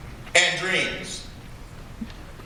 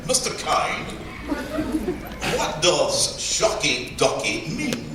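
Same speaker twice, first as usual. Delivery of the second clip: mock British interviewer, slowly drawn-out question; British accent; 4 dB quieter, slower than usual. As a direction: put on a slow, proper British accent. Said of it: "he had a rather British accent".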